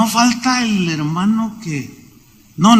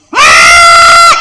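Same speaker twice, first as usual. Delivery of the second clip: loud, low operatic bellow; mock operatic shout; 8 dB louder than usual. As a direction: belt it like a ham opera singer. Imitates loud operatic singer. (grito operático burlón muy fuerte)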